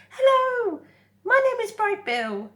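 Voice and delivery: high, loud gosling shout over the waves; excited shout. Acted. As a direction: call out across the water, thrilled. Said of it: "he shouted over the waves".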